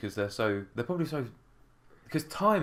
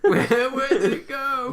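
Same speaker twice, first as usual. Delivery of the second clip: high, loud laughing wail; slow, hammed-up lament; mock wail; 7 dB louder, slower than usual. Mock lament over lost ball.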